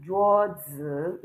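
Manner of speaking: "juozi" with zi drawn out, rising; wrong second tone. Shown as a demonstration. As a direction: slowly lift the pitch through zi. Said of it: "but Z I has no tone".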